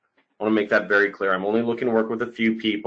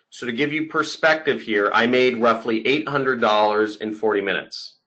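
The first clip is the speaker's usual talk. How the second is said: usual speaking voice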